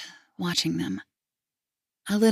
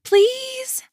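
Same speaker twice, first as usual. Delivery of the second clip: put-on much higher child plea; begging little girl; 6 dB louder than usual. Little girl begging grandpa for a toy, high. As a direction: pitch way up like a pleading child. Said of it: "she asks".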